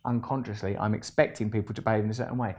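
ordinary delivery

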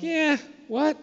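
a high, puzzled mock question; bewildered disciples asking. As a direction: toss it up as a baffled listener. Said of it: "they're like".

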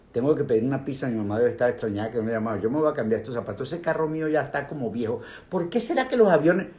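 rattled off very fast, tumbling together; racing thoughts. Retold or imitated. Teacher plays a mind jumping between thoughts, rushed and fast. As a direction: gabble it like a restless mind.

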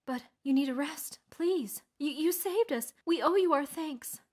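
high, earnest villager voice; halting, high-pitched grateful plea; pleading villager. A narrator's reading. Villager pleads with hero to rest.

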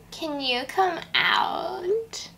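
high, slow, tearful plea; pleading cry. Acted. Michelle begging her friend to come out, crying. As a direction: beg with a crying voice.

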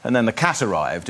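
normal talking voice

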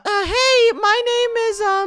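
put-on high falsetto girly voice; man doing a woman's voice. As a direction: squeak it up high like a girl. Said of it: "Get your girl voices ready".

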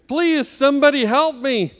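high-pitched pleading cry; calling for help. A narrator's reading. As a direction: beg for help, pitched well up. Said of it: "Murray Brown calling for help".

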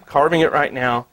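ordinary talk, nothing marked

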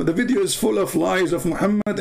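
ordinary talk, nothing marked

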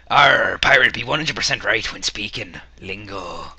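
hammed-up pirate with a growled "Arr"; pirate speak. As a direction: read it out like a stage pirate. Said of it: "find my pirate speak".

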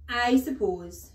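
loud, high, drawn-out scorn; haughty headmistress, loud and slow; scornful headmistress. A narrator's reading. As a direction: stretch it out with heavy sarcasm. Headmistress rants at class.

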